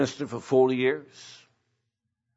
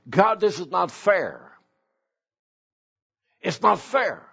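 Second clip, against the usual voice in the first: mild, higher-pitched protest; upset with God; 4 semitones higher than usual. Acted. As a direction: complain to God about unfairness. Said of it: "How many times have you been upset with God?".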